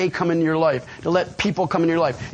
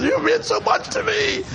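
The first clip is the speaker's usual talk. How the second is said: tearful cry. Retold or imitated. Crying newcomer thanking the speaker after his talk. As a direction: cry it out, overwhelmed. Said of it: "and I'm crying".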